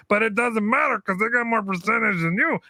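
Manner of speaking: high put-on puppet voice, lightly done; Chucky voice. Voicing Chucky in retold argument.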